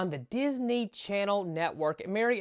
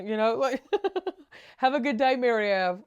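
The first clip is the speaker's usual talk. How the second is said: ordinary delivery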